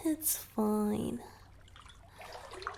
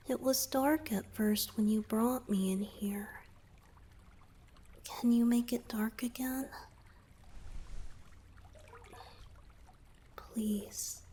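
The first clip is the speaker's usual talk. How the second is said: soft breathy whisper close to the ear; 5 dB quieter than usual. Acted. Merman confiding to his savior in a whisper. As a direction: whisper it like a confession. Asks to dim the lights, whispering.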